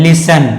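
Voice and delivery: "लिसन" said alone with no t; "लिसन", s straight into the ending; silent t. Shown as a demonstration. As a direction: model "लिसन" as LIS-un. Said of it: "इसको लिसन पढ़ते हैं".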